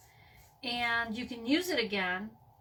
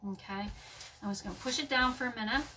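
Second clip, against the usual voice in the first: ordinary talk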